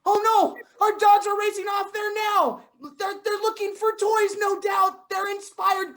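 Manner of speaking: loud, high, rushed alarm; excited alarm. Acted. Owner shouting as the dogs race off, high and loud. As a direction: shout it up high as they run off.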